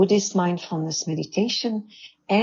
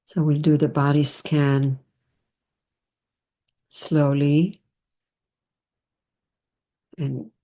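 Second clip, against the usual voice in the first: guided meditation. Real, not acted. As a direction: ease them into stillness, phrase by phrase.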